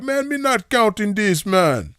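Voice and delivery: high, loud put-on Jamaican accent; mock Jamaican accent. Gas station man refusing the pennies, exaggerated Jamaican accent. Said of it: "in his Jamaican accent".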